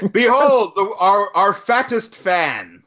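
high, drawn-out heraldic proclamation; mock grand announcer. Host unveiling the biggest fan, mock-grand announcer. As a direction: proclaim it grandly through a laugh.

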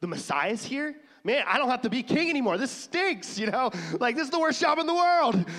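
high, loud, fast mocking whine; whiny Herod. Preacher mocking Herod losing his throne, high whiny complaint. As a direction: play Herod as a sulking spoiled king.

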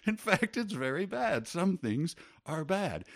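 high, loud, exasperated; frustrated retort. Retold or imitated. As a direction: burst out, fed up.

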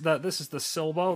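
ordinary delivery

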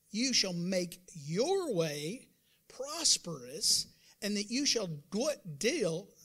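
slow, drawn-out, weighed words; slow emphatic reading. Real, not acted. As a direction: stretch each word for weight. Preacher slows scripture reading.

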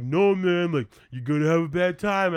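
mock urgent warning. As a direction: act out a friend's insistent warning. Retold friend's warning, acted out.

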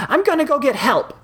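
very high-pitched distressed cry; acted high, urgent shout. A narrator's reading. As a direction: call it out high, in a panic. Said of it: "shouted Octavia".

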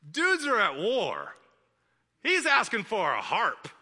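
high, loud and drawn out; comic disbelief. Acted. Preacher reacts to a harp request in wartime, comically incredulous. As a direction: stretch it out in exaggerated incredulity.

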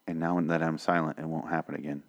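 ordinary talk, nothing marked

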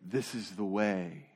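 low, slow Mandalorian creed voice; solemn Mandalorian catchphrase, deep and deliberate. Retold or imitated. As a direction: drop low and slow like a helmeted warrior. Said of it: "they say".

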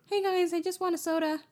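high, light everyday voice; casual high-pitched customer, no hero voice; forgetful Batman off guard. Retold or imitated. Batman walking into the convenience store, forgetting his voice, light and high. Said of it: "walking into the convenience store and he's just like".

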